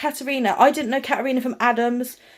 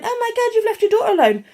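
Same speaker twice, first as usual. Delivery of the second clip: much higher, scandalised mock gasp; pitched-up mock horror at a neglectful mum; mock outrage; 9 semitones higher than usual. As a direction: ham up the shocked busybody. Mocking a scandalised critic.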